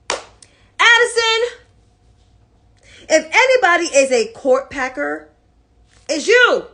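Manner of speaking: loud, high, accusing; real indignant call-out. Real, not acted. Commentator accusing the senator of court packing, indignant.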